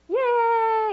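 high, loud cheer; cheering yay. Shown as a demonstration. Teacher demonstrating the kids' happy-face cheer, excited. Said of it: "you'll be like".

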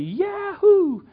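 high, loud joyful whoop; kids' cheer. Retold or imitated. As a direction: whoop like a kid let loose. Kids thrilled about swimming.

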